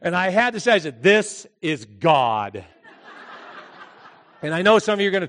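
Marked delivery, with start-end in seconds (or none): 1.0-2.6 low, very slow pronouncement; mock-divine, drawn-out low announcement; voice of God. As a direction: intone it deep and slow, as God. Poses as God to frightened girls.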